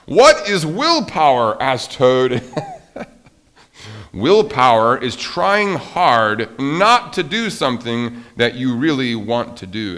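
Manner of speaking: loud, animated read-aloud with big pitch swings; story reading. A narrator's reading. Teacher reads Frog and Toad to children, animated storytelling.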